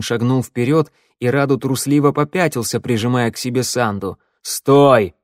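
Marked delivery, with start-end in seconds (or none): 4.4-5.1 loud urgent command; shout. A narrator's reading. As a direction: shout to stop him. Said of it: "закричал змеевик". (крикнуть повелительно)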